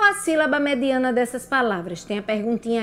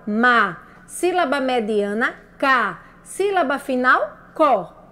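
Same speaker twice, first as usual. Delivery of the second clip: usual speaking voice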